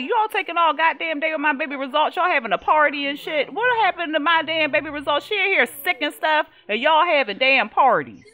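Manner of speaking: furious mom chewing out the clinic; cussing them out. Acted. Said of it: "cussed them out".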